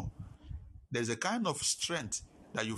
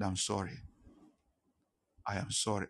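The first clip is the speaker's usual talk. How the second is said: low, soft, subdued apology; retold tearful apology; 7 semitones lower, 6 dB quieter than usual. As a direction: retell a crying apology quietly, without tears. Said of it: "I was crying saying it".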